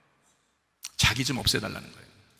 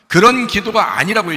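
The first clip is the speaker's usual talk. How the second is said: loud insistence; 5 semitones higher, 9 dB louder than usual. Real, not acted. Preacher insists firmly.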